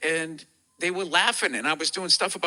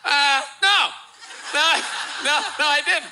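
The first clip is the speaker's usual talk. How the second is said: 1 flustered denial; 7 semitones higher than usual. Retold or imitated. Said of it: "I had to be like".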